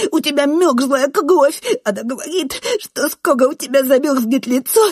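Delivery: high, loud, tearful ranting; frantic whining boy. A narrator's reading. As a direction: pour it out feverishly, R mispronounced. Frenzied boy reporting grandmother's words. (исступлённо, плаксиво, картавя)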